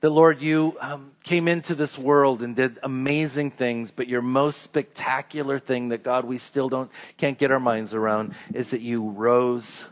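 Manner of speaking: soft, low, reverent prayer voice. Acted. Pastor leading the congregation in closing prayer, soft and reverent.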